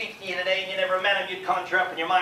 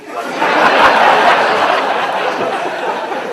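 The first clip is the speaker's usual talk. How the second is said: big laugh; 11 semitones higher, 13 dB louder than usual. Real, not acted. Laughs at peeing joke.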